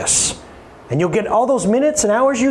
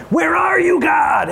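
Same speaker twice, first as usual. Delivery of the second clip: desperate cry; 9 semitones higher, slower than usual. Acted. Crying out to God.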